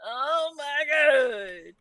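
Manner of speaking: loud, slow frustrated cry; frustrated shout. Real, not acted. Frustrated outburst while gaming.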